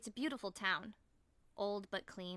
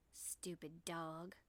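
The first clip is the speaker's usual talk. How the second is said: low, slow, soft Southern drawl; honey-smooth Southern accent; 4 semitones lower, 10 dB quieter, much slower than usual. A narrator's reading. Fallen woman grumbles about her dog, honey-smooth Southern accent. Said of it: "with a honey-smooth southern accent".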